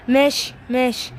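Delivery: usual speaking voice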